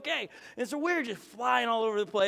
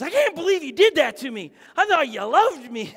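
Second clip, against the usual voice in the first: mock indignant; 6 semitones higher, 7 dB louder than usual. Holly's indignant first words.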